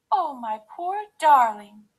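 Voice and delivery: high, loud motherly dismay; Mommy Bear. A narrator's reading. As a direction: gasp in concern for your cub. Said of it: "gasped Mommy Bear".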